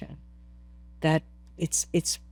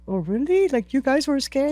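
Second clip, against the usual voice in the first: higher-pitched retold disbelief; surprised; 6 semitones higher than usual. Climber learning the guides were scared, surprised. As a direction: ask it in mild astonishment.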